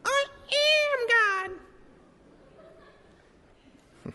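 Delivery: a speck of a person squeaking up to heaven; tiny voice. Retold or imitated. Moses hears a tiny dot on the beach, mock squeaky voice. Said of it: "And it's going".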